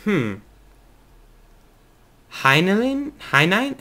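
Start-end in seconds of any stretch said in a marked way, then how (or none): 2.3-3.8 "Heinlein" with a high questioning rise; "Heinlein?" said tentatively, rising sharply at the end; unsure pronunciation. Real, not acted. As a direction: try out "Heinlein" as a question, pitch climbing. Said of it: "I don't know how to actually pronounce that".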